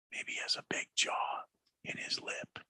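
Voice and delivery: baffled whisper to himself. Shown as a demonstration. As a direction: breathe it out under your breath. Rereads the text under his breath.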